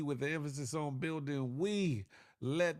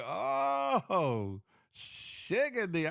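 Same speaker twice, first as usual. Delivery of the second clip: high, drawn-out mock astonishment; retold amazement; 5 semitones higher, much slower than usual. Streamer retelling his reaction to last night, mock amazed. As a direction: stretch it out, hamming up the disbelief. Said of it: "I was like".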